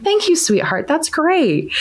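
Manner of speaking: put-on a mother touched, answering her little girl sweetly; tender thanks. Mom thanks daughter tenderly.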